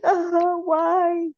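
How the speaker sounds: high, drawn-out cry of dismay; hammed-up anguished whine; mock wail. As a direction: wail it in theatrical despair. Retold dismay at the lost baby.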